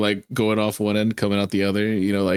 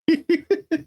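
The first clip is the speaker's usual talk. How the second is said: a squeaky, high-pitched burst of laughter; high giggle. Real, not acted. Streamer cracking up at a clip, high giggle.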